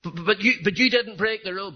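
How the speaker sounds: astonished protest. Retold or imitated. Teacher startled that the innocent boy steps in.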